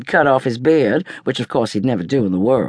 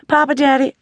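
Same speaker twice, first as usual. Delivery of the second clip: high, sing-song call to Papa Daddy; Stella-Rondo calling sweetly to her grandfather; calling Grandpa; 12 semitones higher, 4 dB louder than usual. A narrator's reading. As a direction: wheedle for his attention. Calling him again.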